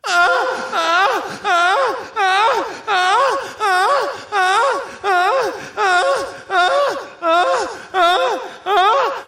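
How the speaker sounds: high wailing ah ah ah; mom crying wail. Retold or imitated. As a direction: wail like a sobbing mother. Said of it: "if we wanted to do an impression of your mom".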